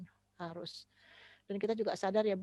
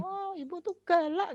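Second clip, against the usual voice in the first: put-on high, slow childish whine; child accusing mother; 10 semitones higher, 8 dB louder than usual. Child tells mother she's mean, high childish whine. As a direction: whine it like a small child calling mom mean.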